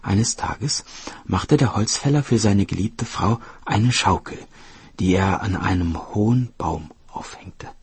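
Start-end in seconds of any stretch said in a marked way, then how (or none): none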